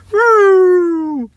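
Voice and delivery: long, loud, high wail; imitated police siren. Imitates arriving police cars.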